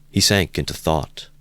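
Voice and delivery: usual speaking voice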